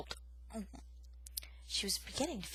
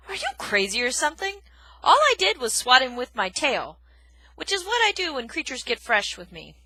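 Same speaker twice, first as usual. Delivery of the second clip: loud, gruff scolding; gruff porcupine; 5 semitones higher, 10 dB louder than usual. Acted. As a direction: scold her roughly. Aerith scolds.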